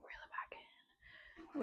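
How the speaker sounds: whispered, almost silent; soft whispered self-reminder. Real, not acted. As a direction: breathe it to yourself. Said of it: "self-instruction".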